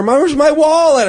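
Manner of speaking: high, drawn-out imitated wail; bellowing woman. Mugged woman hunts for her wallet, imitated bellowing. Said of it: "she was bellowing".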